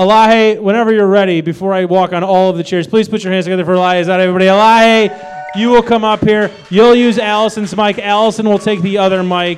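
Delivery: loud stage host intro; host announcement. Acted. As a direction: call the guest up like an emcee.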